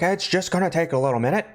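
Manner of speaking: high, hurried mock reassurance; ringleader placating crowd. Ringleader tries to calm a leaving crowd, high hurried placating. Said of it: "trying to calm everybody down".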